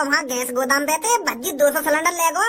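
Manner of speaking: ordinary talk, nothing marked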